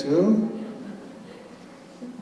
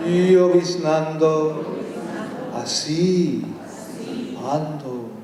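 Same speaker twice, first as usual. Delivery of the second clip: slow, rhythmic Spanish poem reading; dramatic Spanish verse reading; poem recital; slower than usual. Acted. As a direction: chant the verses like an incantation. Reads a Spanish poem aloud.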